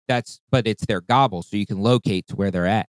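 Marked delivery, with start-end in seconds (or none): none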